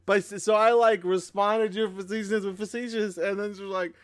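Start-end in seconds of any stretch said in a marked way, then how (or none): none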